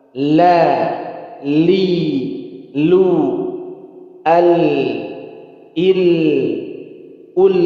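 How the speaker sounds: loud, ringing recitation for the class; lam drill. Shown as a demonstration. Teacher reciting lam syllables loudly for students. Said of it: "Perhatikan lagi, fokus".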